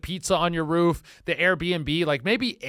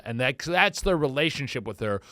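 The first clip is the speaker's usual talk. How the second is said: ordinary talk, nothing marked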